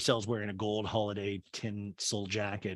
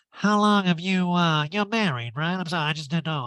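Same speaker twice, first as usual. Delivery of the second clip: raised, put-on voice; Erica impression; 4 semitones higher, 5 dB louder than usual. Erica questions the host's son, raised mock voice. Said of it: "And Eric goes".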